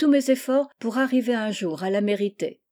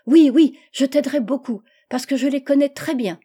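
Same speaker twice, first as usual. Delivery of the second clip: put-on sudden loud, higher "oui, oui" of a delighted child; eager little girl; 5 semitones higher, 7 dB louder than usual. Anne promising to help Gertrude, loud eager child voice.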